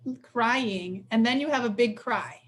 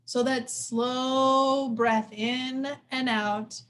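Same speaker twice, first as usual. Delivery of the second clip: ordinary talk, nothing marked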